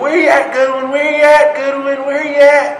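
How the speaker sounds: high, loud repeated holler; imitated drill instructor searching; calling out. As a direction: holler for a missing recruit. Instructor calls for missing recruit.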